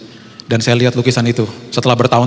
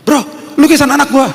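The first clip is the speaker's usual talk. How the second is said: high, eager call to a buddy; pushy sales pitch; 12 semitones higher, 3 dB louder than usual. Retold or imitated. Dad pitching child's painting to a close friend, pushy and high.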